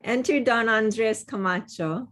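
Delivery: normal talking voice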